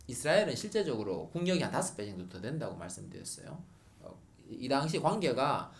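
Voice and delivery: ordinary talk, nothing marked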